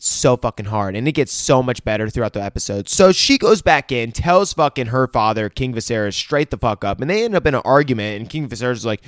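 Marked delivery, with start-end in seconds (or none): none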